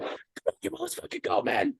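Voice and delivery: high, breathy mock corporal, exasperated; hammed-up impatient corporal; fed-up corporal. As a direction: wave him off like an annoyed squad leader. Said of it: "He was just like".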